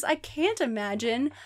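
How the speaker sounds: plain reading voice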